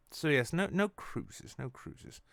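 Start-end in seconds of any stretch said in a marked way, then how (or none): 1.6-2.2 much softer, almost under the breath; trailing off. Acted. Host repeats no cruises to himself, fading out. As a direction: let the verdict die away.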